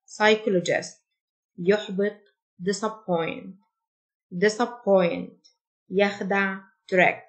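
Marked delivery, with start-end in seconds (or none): 0.1-1.1 "Psychologist" said with no P sound, starting on s; "Psychologist" as sy-KOL-o-jist, P silent. Shown as a demonstration. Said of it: "ال P silent". 4.3-5.5 "Disappoint" said slowly, each syllable clear; careful teacher read of "Disappoint", slow; slow model word. Shown as a demonstration. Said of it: "repeated slowly as model".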